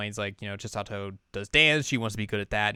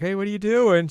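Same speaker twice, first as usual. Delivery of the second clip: overly casual greeting; 11 semitones higher, 7 dB louder than usual. Retold or imitated. Ren casually pretends to greet.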